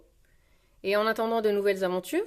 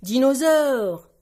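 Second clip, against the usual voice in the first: loud, raised, slowly stretched word; toddler's roaring shout; 4 semitones higher, 8 dB louder, much slower than usual. Acted. George in his dinosaur costume, shouting proudly. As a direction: bellow it like a proud little dinosaur.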